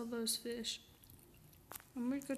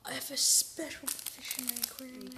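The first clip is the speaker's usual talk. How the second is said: excited whisper; 3 semitones higher than usual. Real, not acted. Awed at new item.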